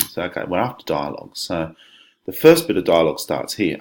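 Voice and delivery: ordinary delivery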